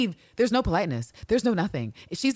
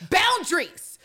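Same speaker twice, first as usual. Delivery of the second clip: high-pitched exclamation; emphatic outburst; 12 semitones higher, 3 dB louder, slower than usual. Real, not acted. Ranting about men not listening to women, high exasperated cry. Calling out men ignoring limits.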